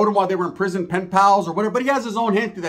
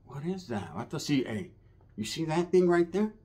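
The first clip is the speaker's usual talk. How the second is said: much softer secret aside; quiet conspiratorial aside; hushed; 3 semitones lower, 9 dB quieter than usual. Retold or imitated. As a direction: whisper it so she won't hear. Whispering to cousin aside.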